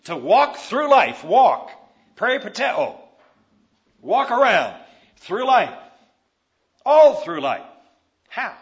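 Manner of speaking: slow, loud preaching with a hammered "life"; forceful preaching. Acted. Preacher urging the congregation to walk through life, loud and slow. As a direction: preach each word out to the congregation.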